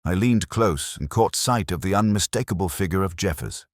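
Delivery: plain reading voice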